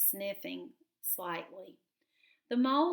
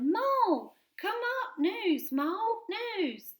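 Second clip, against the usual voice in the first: high excited mouse calling; 12 semitones higher, 4 dB louder, slower than usual. Acted. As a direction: shout the news down the hole.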